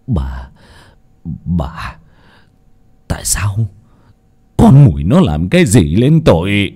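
low, halting, seething voice; through clenched teeth. A narrator's reading. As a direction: grind out each word in fury. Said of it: "nghiến răng nghiến lợi". (giọng nghiến răng, trầm và đứt quãng)

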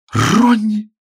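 sharp high cry of a name; shout. A narrator's reading. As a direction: scream the name in alarm. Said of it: "крикнула Сью".

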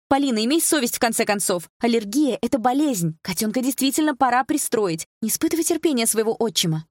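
high-pitched maternal scolding; angry mother. A narrator's reading. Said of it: "А мама рассердилась".

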